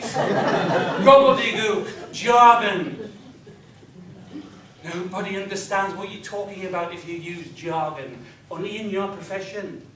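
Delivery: ordinary delivery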